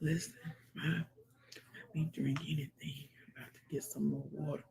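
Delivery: quick hushed whisper. Real, not acted. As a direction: whisper it under your breath. Whispered aside.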